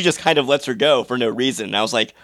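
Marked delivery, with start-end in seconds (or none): none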